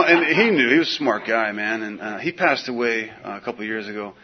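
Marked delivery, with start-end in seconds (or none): none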